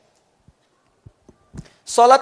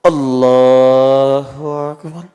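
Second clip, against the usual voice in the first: slow, drawn-out, much louder call; takbir; 2 semitones lower, 12 dB louder, much slower than usual. Shown as a demonstration. Preacher demonstrates the prayer's opening takbir, slow and loud.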